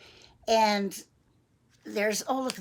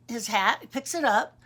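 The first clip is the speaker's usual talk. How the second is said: ordinary delivery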